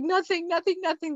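high, rushed brush-off; hasty denial. Retold or imitated. Brushing off coworkers' question.

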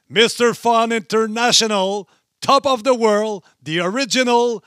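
arena announcer. Acted. Introducing co-host like ring entrance.

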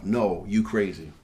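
ordinary talk

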